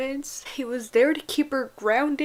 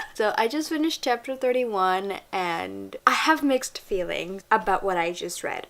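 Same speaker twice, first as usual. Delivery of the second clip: ordinary talk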